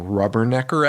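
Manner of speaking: ordinary delivery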